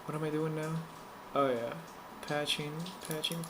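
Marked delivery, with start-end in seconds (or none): none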